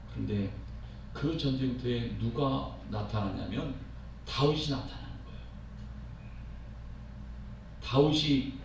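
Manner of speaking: usual speaking voice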